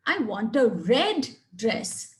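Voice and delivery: "red" stressed, falling, slow. Shown as a demonstration. Teacher showing stress on "red" to contrast colours. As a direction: hit "red" to rule out other colours. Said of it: "means not blue, not green".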